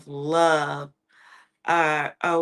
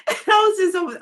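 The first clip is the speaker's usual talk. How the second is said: laughing; 10 semitones higher, 4 dB louder, much faster than usual. Real, not acted. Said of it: "I was laughing".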